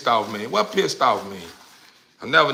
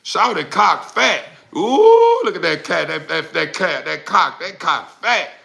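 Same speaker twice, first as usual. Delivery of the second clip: imitating old heads; 5 semitones higher, 4 dB louder than usual. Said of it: "forty ounce drinkers used to be saying".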